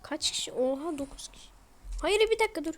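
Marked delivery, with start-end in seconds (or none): 2.0-2.7 alarmed outburst. Real, not acted. Enters dangerous zone.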